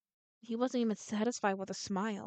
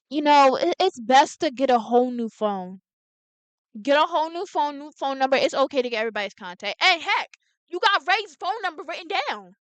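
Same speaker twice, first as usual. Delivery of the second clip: loud, insistent advice; loud urging; 3 semitones higher, 10 dB louder than usual. Acted. Reader urging the threatened character to change phones, loud and insistent. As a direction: blurt it out loudly, pushing the point.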